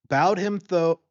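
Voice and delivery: plain reading voice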